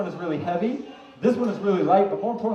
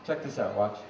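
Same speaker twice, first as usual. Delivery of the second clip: usual speaking voice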